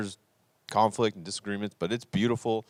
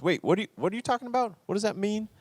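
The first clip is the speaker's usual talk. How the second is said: high, fast, baffled asking; squeaky mock confusion; puzzled listener; 14 semitones higher, faster than usual. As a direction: play the confused crowd member.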